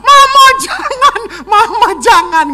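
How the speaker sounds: put-on 1 children wailing. Children crying at prayer.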